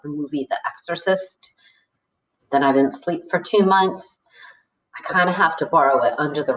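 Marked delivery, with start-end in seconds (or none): none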